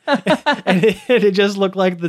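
laughing through the words; giggling while telling it. Real, not acted. Laughing at funny memory.

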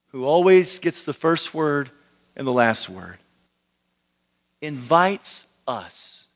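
low, measured phrases with long pauses; really slow. Shown as a demonstration. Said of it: "I'll try to speak really slowly".

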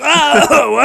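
high, loud comic "oh"; hammed-up wail breaking into laughter; mock distressed cry. Imagining Marty's broken wrists, mock distressed cry.

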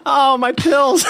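laughing dismay at spilled pills. Real, not acted. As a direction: wail it through real laughter. Pills all over floor.

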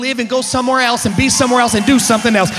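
loud, driving gospel preaching; preaching at full force. Real, not acted. Preacher telling congregation he's alive, full-force preaching. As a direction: build it relentlessly and rouse the room.

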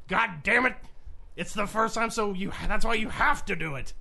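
loud, high, exasperated rant; exasperated boss. Retold or imitated. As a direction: huff it out like an impatient boss. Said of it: "he's like".

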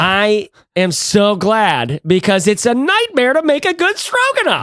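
drawn out, climbing into a high squeal; mock heartfelt relief. Host jokes about a nightmare stroganoff, mock heartfelt.

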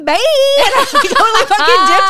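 high, loud laughing telling. Real, not acted. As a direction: laugh through the punchline.

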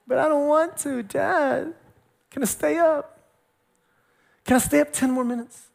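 high, mock whiny kid voice; pleading child. Daughter asking dad to stay up, high mock pleading. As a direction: plead like a kid stalling bedtime.

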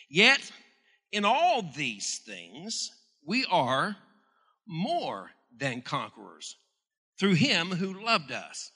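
slow, swelling preacher's proclamation; preaching scripture. Acted. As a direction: proclaim it slowly, building to him. Preacher reads scripture climax.

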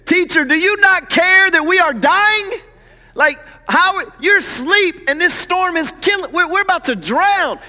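loud, high, desperate shouting; panicked disciples. Acted. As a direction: cry out in alarm as if about to drown. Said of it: "That's not how they said it".

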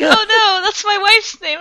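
high, loud, laughing near-wail; high-pitched tearful-sounding gushing; overwhelmed squeal. Retold or imitated. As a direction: melt with delight, voice way up. Squealing over the retold cute moment.

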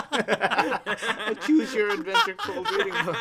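high, slow, laughing through words; giggling while saying it. Real, not acted. Laughing excitedly at idea.

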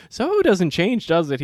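loud, high sarcastic quip; loud ironic quip. Retold or imitated. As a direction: call it out with mock cheer. Loud retold remark to guy.

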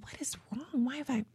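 breathy whisper of a troubled mind; whispered worry. Retold or imitated. Host whispering her own worried thoughts. Retelling anxious inner thoughts.

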